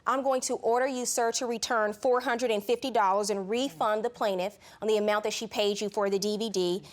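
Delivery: ordinary delivery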